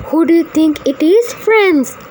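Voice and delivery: eager storyteller question. Real, not acted. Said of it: "asks the children".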